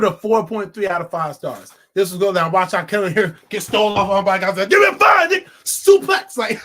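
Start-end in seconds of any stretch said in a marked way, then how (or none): 4.7-6.4 high, very loud outburst; explosive, high hype yell; clowning shout. Acted. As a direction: yell it like a wrestling move.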